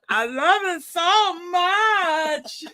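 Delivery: put-on very high, drawn-out Colombian accent; Sofia Vergara. Impersonating the Colombian star for laughs, high slow mock accent. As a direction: squeal it slowly like a hammed-up Colombian actress. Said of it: "Sofia Vergara".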